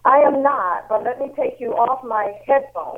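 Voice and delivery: muffled Bluetooth headset sound; speaking through a bad headset; headset audio. Real, not acted. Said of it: "it doesn't sound good".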